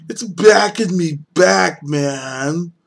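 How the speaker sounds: high, loud, slow emphatic exclaim. Real, not acted. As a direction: drag it out loudly in shock. Beer taste hits again.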